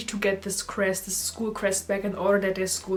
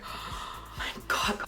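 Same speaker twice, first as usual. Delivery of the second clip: very soft, slow, breathy whispered awe; awed whisper; 9 dB quieter, much slower than usual. Retold or imitated. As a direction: let it slip out in stunned wonder. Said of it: "I'm like".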